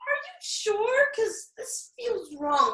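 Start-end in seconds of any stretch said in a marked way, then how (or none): 0.0-1.1 high, drawn-out, doubtful question; deeply unconvinced and uncomfortable; squirming doubt. Acted. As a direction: stretch it out uneasily, pitch way up. Squirming at awkward advice.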